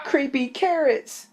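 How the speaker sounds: high, slow terrified shout; frightened shout. Acted. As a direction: cry out in fright. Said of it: "he shouted".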